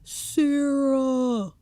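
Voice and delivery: high, pleading cries from inside a suitcase; desperate calls. Retold or imitated. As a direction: call her name like you're trapped and scared. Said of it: "He's like".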